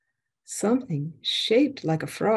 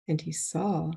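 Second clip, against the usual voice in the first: ordinary delivery, nothing marked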